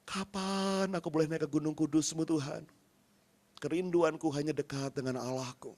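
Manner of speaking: tired whispered-down prayer to God; quiet prayer. Acted. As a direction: pray it close, fading to almost nothing. Quoting intimate prayer.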